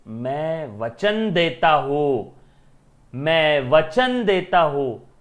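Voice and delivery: loud, slow, solemn oath like an epic king; grand vow. Acted. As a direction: declaim the vow like a movie hero. Katappa's promise acted as Bahubali film. (फ़िल्मी अंदाज़ में, धीमी, ज़ोरदार प्रतिज्ञा)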